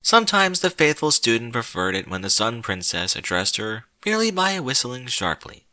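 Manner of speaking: ordinary delivery, nothing marked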